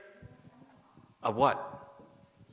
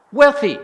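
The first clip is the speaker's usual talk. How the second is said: wealthy punched high and much louder; stress on wealthy; 8 semitones higher, 12 dB louder than usual. Shown as a demonstration. Teacher glossing the sutra term to students, stressing "wealthy". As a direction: hit "wealthy" hard, high and loud.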